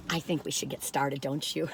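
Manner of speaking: low, soft sinister tease; evil voice. Acted. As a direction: purr it like a villain. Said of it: "I sound evil".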